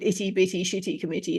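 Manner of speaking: crisp British accent with every T sounded in "itty bitty shitty committee". Shown as a demonstration. Contrasting with the Canadian version, a plain British accent. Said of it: "if I say it in my British accent".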